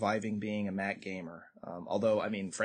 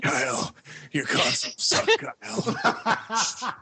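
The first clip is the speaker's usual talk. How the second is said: Batman voice; 9 semitones higher, 4 dB louder, much slower than usual. Pretend. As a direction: growl it like a gruff caped hero. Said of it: "you go into Batman voice".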